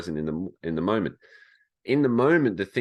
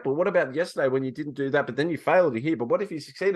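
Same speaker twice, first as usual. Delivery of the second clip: high, fast, fretful chattering voice; anxious inner voices; 8 semitones higher, 3 dB louder, faster than usual. Pretend. The anxious inner voices nagging Mitch, high and frantic. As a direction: rattle off doubts in a high nervous voice. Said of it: "the voices is like".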